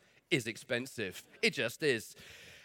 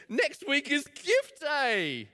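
mock grand announcement; 10 semitones higher, 5 dB louder, much slower than usual. Mock announcement of gift day.

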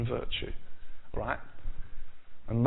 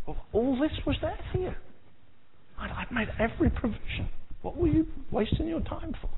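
high, gentle voice of Jesus, raised well up; Jesus speaking; 9 semitones higher, 4 dB quieter than usual. Retold or imitated. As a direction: play Jesus gently asking why you wasted it. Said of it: "he said".

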